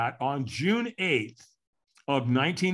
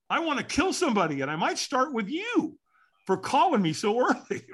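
much higher, mock-furious grumble ending in a laugh; half-joking threat that breaks into laughter; mock-angry threat; 10 semitones higher than usual. To the friend calling too early, mock-angry higher threat. Grumpy reply, ends laughing.

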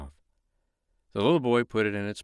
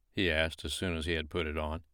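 ordinary delivery

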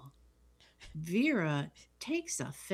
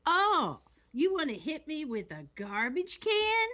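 high-pitched acted outrage; imitated affronted fighter; indignant threat; 9 semitones higher than usual. Retold threat after being hit.

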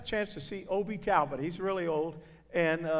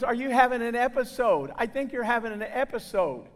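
higher-pitched New York woman, concerned; New York lady; 6 semitones higher, 4 dB louder than usual. Retold or imitated. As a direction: imitate a nosy little lady from New York. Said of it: "I think from New York, from the sound of her voice".

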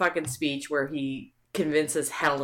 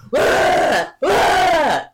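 high loud wah-wah wail; wailing siren; 11 semitones higher, 7 dB louder than usual. Retold or imitated. Imitating siren or crying.

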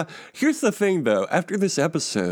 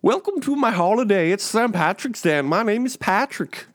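high, cheery stage Irish voice; hammy Irish lilt, pitched high; Irish accent; 9 semitones higher than usual. Pretend. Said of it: "turned into, like, a smiley Southern accent".

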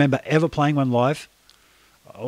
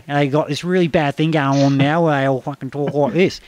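broad Aussie accent. Shown as a demonstration. Griping to the host about how Aussies now talk, broad Australian accent. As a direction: keep the broad Australian voice going, a touch mocking.

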